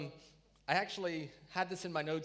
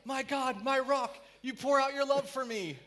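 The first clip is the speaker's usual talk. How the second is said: high, impassioned declaration; a touch of dramatic adoration, pitched up; fervent praise; 8 semitones higher, 4 dB louder than usual. Acted. Psalmist calling on his rock, high and fervent.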